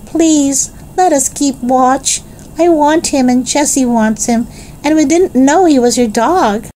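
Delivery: put-on high-pitched child pleading; impatient little Benny. A narrator's reading. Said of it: "But Benny could not wait".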